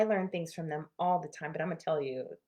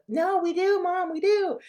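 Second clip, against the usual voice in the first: put-on kids protesting; 13 semitones higher, 9 dB louder, slower than usual. Kids protesting back at mom.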